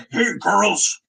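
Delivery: loud barking drill sergeant call. Shown as a demonstration. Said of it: "I imagine he talks like a drill sergeant".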